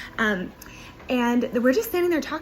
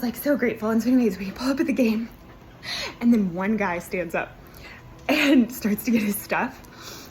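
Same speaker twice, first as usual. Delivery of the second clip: ordinary talk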